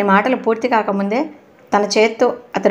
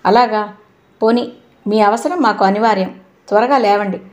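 plain reading voice

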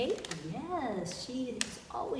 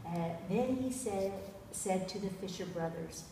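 usual speaking voice

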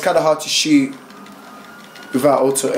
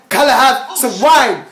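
louder, impatient demand; insistent louder repeat; 6 semitones higher, 4 dB louder than usual. Acted.

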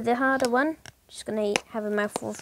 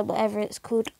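ordinary talk

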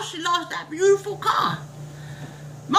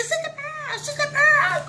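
much higher voice calling a name; calling across the store; 12 semitones higher than usual. Acted. Neighbor spotting Pearl in the grocery store, calling high. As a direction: sing out her name from across the aisles.

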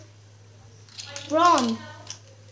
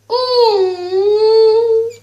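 long, loud, high mock howl; drawn-out wail; 7 semitones higher, 10 dB louder than usual. Player at the dog shut outside, drawn-out wail.

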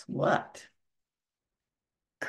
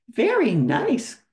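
higher, louder, slow praise; cried out; 8 semitones higher, 6 dB louder than usual. Acted. Christopher Robin praising Pooh's writing, cried out higher. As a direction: cry it out in delight. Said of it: "cried Christopher Robin".

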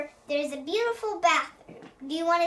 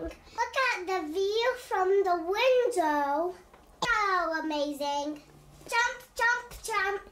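ordinary delivery

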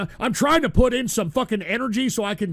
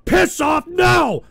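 high, loud yelling at the heckling clip; angry shout; 4 semitones higher, 4 dB louder than usual. Real, not acted. Streamer yells at the soundboard clip interrupting him, angry shout. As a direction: snap back furiously, then keep hammering it.

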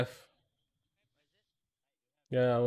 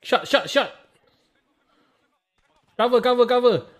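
shouting; 11 semitones higher, 8 dB louder than usual. Real, not acted. Firefight callouts.

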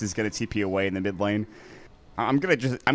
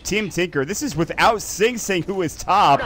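excited play-by-play; 3 semitones higher, 6 dB louder than usual. Real, not acted. Loud call of team wipe.

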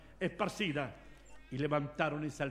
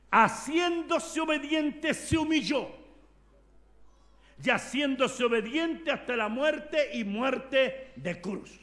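slow preaching emphasis; 6 semitones higher, 5 dB louder, slower than usual. Acted. Preacher to congregation, slow solemn emphasis. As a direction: stretch out haciéndose obediente solemnly.

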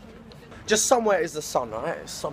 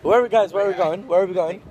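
loud, higher call to friends; 5 semitones higher, 6 dB louder than usual. Real, not acted. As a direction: shout the question. Calling to friends on street.

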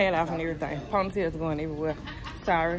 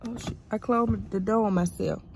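ordinary delivery

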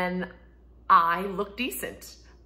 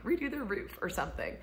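ordinary talk, nothing marked

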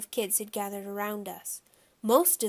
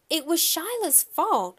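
high, loud indignant defense; indignant child's protest; 8 semitones higher, 5 dB louder than usual. A narrator's reading. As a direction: push the blame elsewhere. Boy protests being blamed.

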